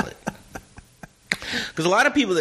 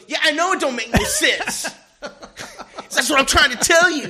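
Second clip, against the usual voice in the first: laughing quote; 11 semitones higher than usual. Retold or imitated.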